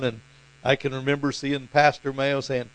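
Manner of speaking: ordinary delivery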